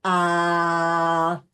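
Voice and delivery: low, steady open tone. Shown as a demonstration. Coach demonstrating a grounded tone to the class. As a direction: let one deep note ride out on the breath. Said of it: "Let's just go on".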